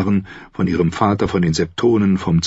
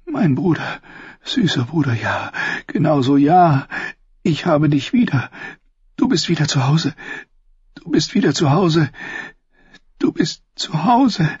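high, rapturous woman's voice; impassioned Cersei; 10 semitones higher than usual. Acted. Sister welcoming her lover home, high rapturous voice.

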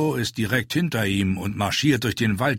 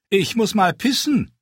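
lightly voiced dwarf, higher, blunt announcement; a touch of a cheeky dwarf making his announcement; dwarf announcing; 12 semitones higher, 4 dB louder than usual. A narrator's reading. Dwarf tells the fat man and riders he must piss, lightly raised.

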